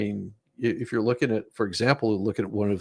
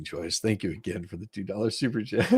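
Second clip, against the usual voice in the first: ordinary talk, nothing marked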